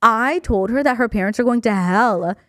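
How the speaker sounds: usual speaking voice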